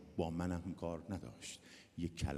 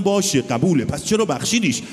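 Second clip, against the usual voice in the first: loud pressing question; 11 semitones higher, 19 dB louder than usual. Retold or imitated. Doctor pressing the mother.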